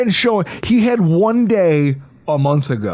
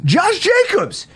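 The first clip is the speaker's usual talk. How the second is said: much higher, slow, drawn-out call of the name "Josh Jacobs!"; excited exclamation; slower than usual. Shown as a demonstration. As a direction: belt out "Josh Jacobs!" like an announcer. Naming a holdout, then exclaiming.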